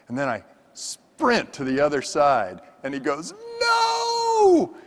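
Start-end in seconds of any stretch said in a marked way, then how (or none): 3.6-4.8 sudden very high, loud warning cry; alarmed shout. Retold or imitated. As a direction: yell to stop him in his tracks. Partner yells as he sprints.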